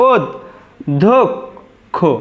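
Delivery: ওদ, ধোক, খো with long pauses between; correct pronunciation, syllabified. Shown as a demonstration. Teacher showing students the correct pronunciation, one syllable at a time. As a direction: say ওদ then ধোক then খো, each apart. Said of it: "আমাদের শুদ্ধ উচ্চারণ কিন্তু এটিই হবে".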